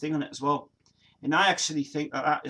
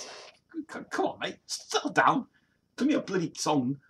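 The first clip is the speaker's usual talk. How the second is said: exasperated plea; 8 semitones higher, slower than usual. Retold or imitated. Exasperated at [name]'s silliness.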